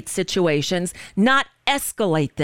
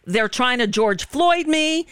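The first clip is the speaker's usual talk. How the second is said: imitated screamed plea; 7 semitones higher than usual. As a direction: mimic a man pleading for his life. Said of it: "You hear him screaming".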